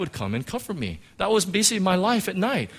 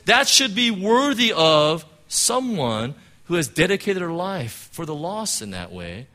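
ordinary talk

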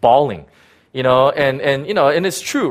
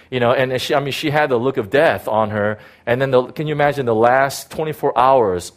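ordinary talk

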